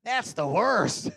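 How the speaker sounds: slow, high, loud groan; loud complaint. Retold or imitated. Complaining about something awful, loud slow wail. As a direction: wail it out in disgust. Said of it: "It goes right along this one".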